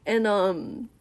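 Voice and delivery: ordinary talk, nothing marked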